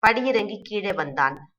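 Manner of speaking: ordinary delivery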